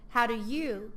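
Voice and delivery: ordinary delivery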